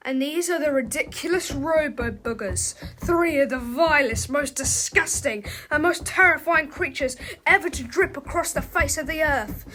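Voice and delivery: booming storyteller build-up; dramatic narration. A narrator's reading. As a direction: announce the monsters with mock menace. Dramatic villain introduction.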